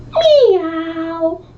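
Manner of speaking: high kitten meow from a puppy; cat meow. Acted. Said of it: "And George went".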